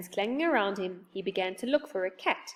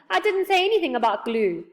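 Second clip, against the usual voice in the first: loud, high protest; exasperated correction; 8 semitones higher, 6 dB louder than usual. A narrator's reading. Friend correcting the deaf saucepan man, high and exasperated. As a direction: insist over the mishearing.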